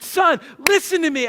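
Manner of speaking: acted loud, high appeal; urgent dad demanding attention, re-enacted; retold pleading. Father makes his crying son listen, pleading loudly. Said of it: "I literally started pleading with him".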